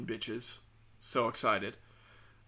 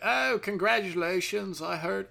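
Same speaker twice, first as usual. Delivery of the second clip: higher-pitched Indian British agent; mock an Indian British accent, pitched up; 5 semitones higher than usual. Fraud agent congratulating a customer, Indian British accent. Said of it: "Indian British guy".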